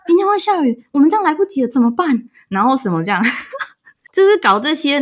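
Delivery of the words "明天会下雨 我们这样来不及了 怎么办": mock worried mother. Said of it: "用很破的英文跟我讲".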